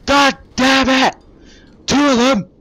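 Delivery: high, loud raging yells; furious shouting. Real, not acted. Gamer raging that his shot failed to destroy the LAV, furious shouting. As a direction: scream at the game that cheated you. Tank fails to destroy vehicle.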